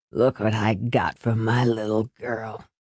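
put-on low, soft, slurring drunk dad; drunk father.

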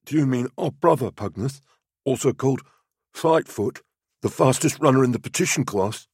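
high, put-on voice of an innocent youth; feigned innocence. A narrator's reading. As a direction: answer the sergeant with mock innocence. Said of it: "as innocently as a youth".

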